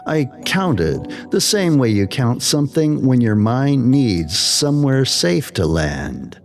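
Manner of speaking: usual speaking voice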